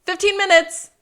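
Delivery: loud bargaining. Retold or imitated. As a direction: throw the number out loud and high. Sean one-ups the offer.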